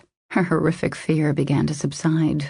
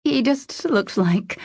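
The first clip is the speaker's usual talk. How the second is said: slow, higher, fading unfinished phrase; trailing off helplessly; 7 semitones higher, slower than usual. A narrator's reading. Kylie explaining her panic to Jensen, trailing off helplessly. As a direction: let the thought die helplessly. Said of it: "she trailed off helplessly".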